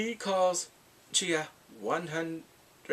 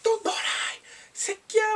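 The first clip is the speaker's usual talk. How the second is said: high, loud swearing outburst; mock cussing; 5 dB louder, slower than usual. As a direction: curse in a squealed tirade. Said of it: "she's cussing".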